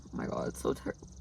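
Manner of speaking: fast breathless whisper; quick horrified whisper. Real, not acted. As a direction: hush it to yourself. Hushed aside about the crash.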